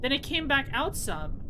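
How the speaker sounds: ordinary talk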